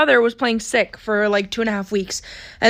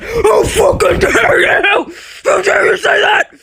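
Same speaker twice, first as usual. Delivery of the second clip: outraged scream, high and loud, drawn out; mother's furious shout; 10 semitones higher, 5 dB louder, slower than usual. Retold or imitated. Mom outraged at daughter's reply about her birthday, furious shout. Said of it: "And she said".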